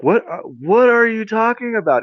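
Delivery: high-pitched incredulous question; baffled. Acted. Woman questions wizard about his prophecy, baffled. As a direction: act out total bafflement, voice shooting up.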